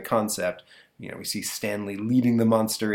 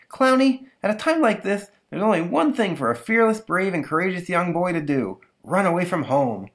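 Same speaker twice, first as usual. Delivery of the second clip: much higher mock-brave kid voice; heroic little boy; 9 semitones higher, 4 dB louder than usual. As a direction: declare a brave plan to his toy. Boy tells toy clown.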